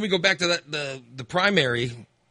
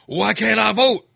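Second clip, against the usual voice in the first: raised, louder mock complaint; higher and louder, an irate voter demanding; retold angry voter; 7 semitones higher, 6 dB louder than usual. Voter at the polls complaining to a volunteer, mock angry. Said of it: "they'll be angry, saying".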